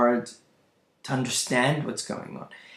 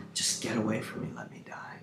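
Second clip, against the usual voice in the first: hushed, unvoiced plea; very soft, fading to breath; despairing whisper; 3 semitones lower, 9 dB quieter, faster than usual. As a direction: whisper it as if giving up. Voices a despairing man wanting death.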